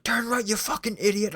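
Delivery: high, loud furious yell in a put-on voice; raging dad's voice barking out of a sat-nav; abusive father yelling. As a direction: scream abuse at the driver. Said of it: "a G P S with their voice".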